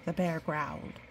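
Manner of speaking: ordinary delivery, nothing marked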